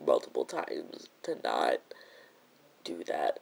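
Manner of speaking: soft, halting, voice nearly gone to a whisper; real hurt, sighing between broken phrases; close to tears. Real, not acted. Telling viewers how the best friend refused an apology, close to tears.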